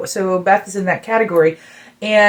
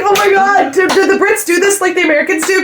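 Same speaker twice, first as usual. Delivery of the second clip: embarrassed fan; 11 semitones higher than usual. Retold or imitated. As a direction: blurt it out in embarrassment, much higher. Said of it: "I was like".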